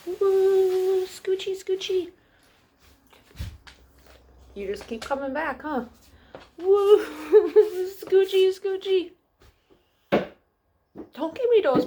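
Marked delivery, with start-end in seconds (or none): 0.1-2.1 coaxing the dog. Real, not acted. 6.6-9.1 high, cheerful sing-song at the dog; laughing dog coaxing. Real, not acted.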